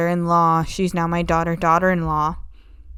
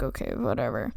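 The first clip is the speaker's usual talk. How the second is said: "Okay, whatever" mumbled much lower and softer; muttered okay whatever; 10 semitones lower, 9 dB quieter than usual. Retold or imitated. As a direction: Countess only half-saying it, a low mutter. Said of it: "Not actually, but she's kinda like".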